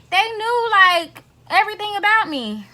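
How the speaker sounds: high, emphatic and indignant. Real, not acted. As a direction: stress how much they knew. Stressing betrayal by friends.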